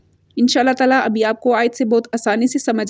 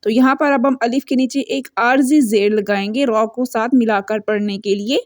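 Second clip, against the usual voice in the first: ordinary talk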